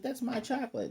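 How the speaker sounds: high whiny protest; indignant squeak. Retold or imitated. Gamamon protesting the impostor ate his chocolate, high and whiny.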